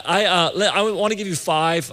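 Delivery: normal talking voice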